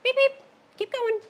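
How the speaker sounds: very high-pitched little beeps; tiny high cartoon voice; playful shooing. Real, not acted. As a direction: squeak it high to hurry the pets along. Shooing the dogs along.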